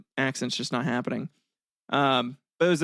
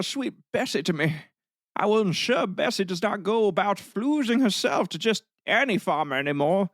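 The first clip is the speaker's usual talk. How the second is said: higher-pitched, hammed-up old-timey farmer; crude farmer; 4 semitones higher than usual. Farmer thanking officers for his cow, in a high mock voice. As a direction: play a pompous, lewd cow owner, pitched up. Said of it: "would say".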